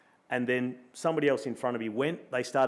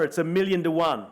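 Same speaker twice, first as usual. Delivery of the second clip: movie quote voice; 4 semitones higher, 5 dB louder than usual. Retold or imitated. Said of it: "that line out of Dumb and Dumber".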